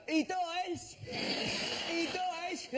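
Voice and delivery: put-on high, slurred drunken heckle; drunk passerby. Drunk man taunting the queue with spoilers. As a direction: shout it like a drunk wandering past. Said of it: "someone who's drunk would just wander past and go".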